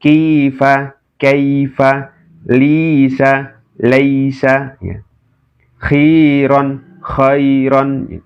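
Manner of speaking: each word read slowly, the ai kept short; slow lin drill. Shown as a demonstration. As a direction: recite the word list like a tajwid teacher. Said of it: "Dibaca apa adanya ya".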